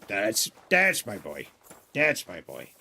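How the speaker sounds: a lightly voiced off-cadence Henry; low, slightly off take on the line; imitating stand-in actor. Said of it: "the cadence is a little off".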